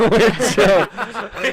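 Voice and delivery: laughing as she repeats it; giggling through the words. Real, not acted. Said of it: "that's a cop-out answer".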